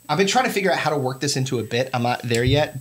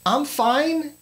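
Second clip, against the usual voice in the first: higher incredulous questions; retold baffled reply; 9 semitones higher than usual. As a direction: sound baffled and alarmed. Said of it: "And I'm like".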